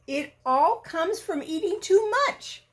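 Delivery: tut-tut at a greedy friend; lightly scolding rabbit. Acted. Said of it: "said Rabbit".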